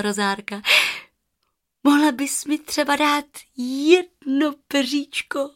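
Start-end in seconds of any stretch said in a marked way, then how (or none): 1.8-5.5 put-on high, sweetly pleading girl voice; small girl's hopeful request, pitched up; asking girl. A narrator's reading. Girl asks the goose for a feather, pleading.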